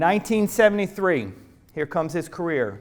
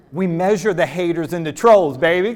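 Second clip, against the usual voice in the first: louder, telling his daughter straight; firm reassurance; 9 dB louder than usual. Retold or imitated. Father reassuring his upset daughter on the phone, loud and firm. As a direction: bold fatherly declaration. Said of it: "I'm like".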